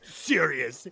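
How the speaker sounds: dropped low, mock-serious. Shown as a demonstration. Explaining their new running joke, mock-serious. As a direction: say it low, as if in earnest. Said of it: "supposed to be, like, you know".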